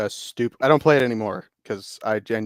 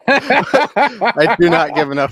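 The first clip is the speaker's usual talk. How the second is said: loud, higher, slow words through laughter; laughing; 7 semitones higher, 9 dB louder, slower than usual. Real, not acted. Not caring about the abbreviation, laughing. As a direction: say it while cracking up. Laughing hard after a joke.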